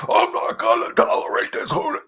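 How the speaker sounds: high, loud hammed-up yelling; mock angry shout. Shown as a demonstration. Trainer showing the wrong, shouting way to enter a house, hammed up. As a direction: bark at the dog like a bully, then cut off. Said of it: "no, no. it's the opposite".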